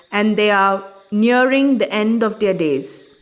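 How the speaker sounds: normal talking voice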